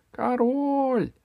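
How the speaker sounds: high, drawn-out call. Acted. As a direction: call to wake a sleeping king. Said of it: "позвала Алиса". (протяжный оклик)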